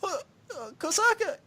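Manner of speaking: very high stammering cry for Kosaka; hammed-up high-pitched panic; panicked girl. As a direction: stutter her name in a high mock panic. Haruka voiced panicking.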